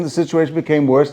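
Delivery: normal talking voice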